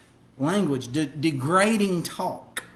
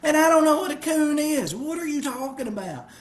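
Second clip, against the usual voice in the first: higher-pitched, bewildered protest; exasperated retort; 8 semitones higher than usual. Retold or imitated. Driver retelling his reply to the yelling worker, exasperated. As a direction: argue back in a raised pitch. Acted quarrel with dock worker.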